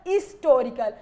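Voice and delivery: historical with the h dropped. Shown as a demonstration. Teacher showing the old reading, historical without h. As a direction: begin historical on a bare "i". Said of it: "हिस्टोरिकल को क्या पढ़ा जाता".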